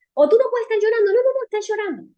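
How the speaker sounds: a hammed-up parent snapping at a kid to stop crying; scolding parent. Preacher imitates parents scolding a crying boy, mock stern and fast. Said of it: "los padres que le dicen a los niños".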